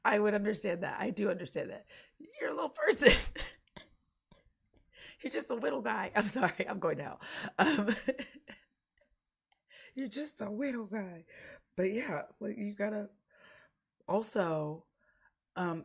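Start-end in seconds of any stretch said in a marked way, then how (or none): 2.4-3.2 high, loud tease breaking into laughter; amused squeal into a laugh; laughing exclamation. Real, not acted. Bursts out laughing at listener. 5.2-6.1 lightly put-on cutesy coo, pitched higher; a hint of higher baby talk; mock cutesy talk. Teasing cooing at small person. 10.0-11.2 higher, put-on cutesy coo; playful baby-talk tease; mock baby talk.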